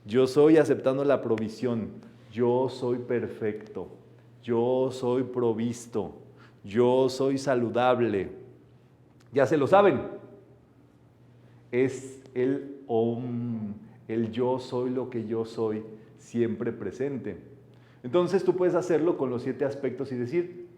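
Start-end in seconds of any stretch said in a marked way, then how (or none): 2.3-8.3 calm spiritual affirmation read; slow affirmation. Acted. 11.7-17.4 very slow, soft meditation voice; hushed mantra-like delivery; meditative intoning. Acted. Meditative explanation of om.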